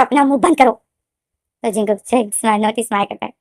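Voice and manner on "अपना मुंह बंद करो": mildly angry, slightly raised voice; angry command. A narrator's reading. V angrily silences Jungkook, a mildly angry command. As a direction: snap it with some anger. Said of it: "गुस्से से और बोला था".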